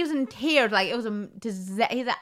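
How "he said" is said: ordinary talk, nothing marked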